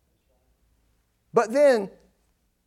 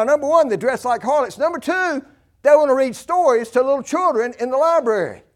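louder, higher, hammering each point; preaching at full force; 5 semitones higher, 5 dB louder than usual. Acted. Preacher rousing the congregation against drag queens, full force.

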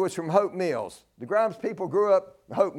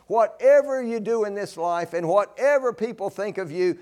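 ordinary talk, nothing marked

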